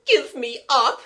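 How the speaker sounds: high, loud outburst; demanding maid. Acted. Eliza breaking the silence with a demand, loud and high. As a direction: burst out loudly, pitched right up.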